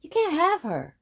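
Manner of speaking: high, loud defiant no; loud refusal. Acted. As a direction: refuse loudly. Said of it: "said the boy".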